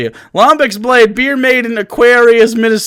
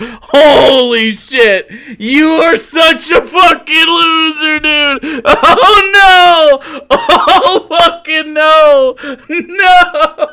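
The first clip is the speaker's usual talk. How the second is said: crying laughter; 6 semitones higher, much slower than usual. Real, not acted. Streamer howling with laughter at the troll's purchase. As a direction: laugh so hard the words break.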